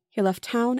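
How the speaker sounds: plain reading voice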